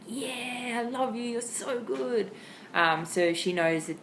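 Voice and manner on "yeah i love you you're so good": breathy whisper, slow and delighted; whispered excited praise. Shown as a demonstration. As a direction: hush out the praise as she finally gets the trick. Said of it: "I'm like".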